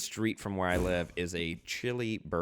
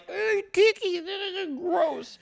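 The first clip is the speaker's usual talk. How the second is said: much higher mock voice; mocking cohost; 4 dB louder, much slower than usual. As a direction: parody her cookie hate. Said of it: "and you're like".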